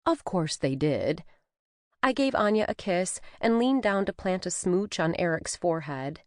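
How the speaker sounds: ordinary delivery, nothing marked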